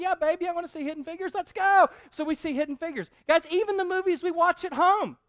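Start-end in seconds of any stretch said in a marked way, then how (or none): 0.0-1.9 high, fast mock enthusiasm; fake eager yes. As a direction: overplay the excitement for her. Pretending eagerness to wife.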